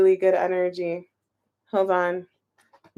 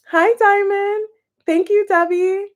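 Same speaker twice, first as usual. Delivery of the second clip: bright greeting; 9 semitones higher, 4 dB louder than usual. Real, not acted. Greeting and thanking viewers.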